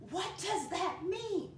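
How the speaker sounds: high, slow, drawn-out question; exaggerated high-pitched wondering; asking oneself. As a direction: act out a puzzled learner's big question. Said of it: "that's the question".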